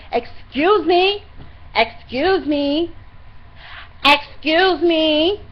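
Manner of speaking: mock shout, high and drawn out, rising at the end; loud, rude imitation. Woman right in her face on a crowded bus, loud mimicry. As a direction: mimic someone loudly demanding to get past. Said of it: "being loud like that".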